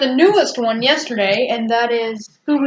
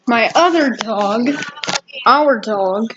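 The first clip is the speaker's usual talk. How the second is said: normal talking voice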